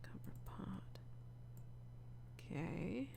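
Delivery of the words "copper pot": whispered note to self. Real, not acted. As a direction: breathe the words unvoiced, reading. Reading notes to self.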